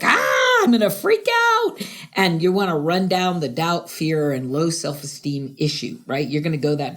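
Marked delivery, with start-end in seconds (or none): 0.0-1.8 high, loud mock panic; panicked brain. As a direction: squeal it like the brain losing it. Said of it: "your brain is like".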